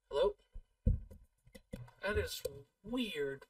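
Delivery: usual speaking voice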